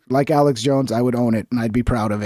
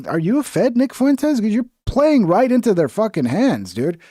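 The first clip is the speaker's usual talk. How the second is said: raised, higher challenge to Nick Fuentes; accusing a streamer of being a fed, higher and raised; calling out Fuentes; 7 semitones higher than usual. Retold or imitated. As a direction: call him out, half-yelling. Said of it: "That's why I'm like".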